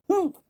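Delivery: very high squeaky humph; young kangaroo's high humph; tiny humph. Acted. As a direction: echo the mother's snort as a baby. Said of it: "the young kangaroo in her pouch said".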